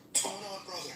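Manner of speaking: high-pitched caller's voice; concerned friend. Retold or imitated. Ron phones his crying friend in the woods, high concerned voice.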